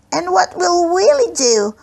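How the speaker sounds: put-on high, squeaky little boy's voice; squeak. A narrator's reading. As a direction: squeak it out like a small anxious kid. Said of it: "squeaked Smokey Joe".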